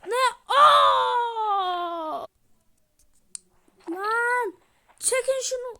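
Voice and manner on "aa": loud startled scream; sudden loud scream of shock. Real, not acted. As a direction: let out a shocked cry.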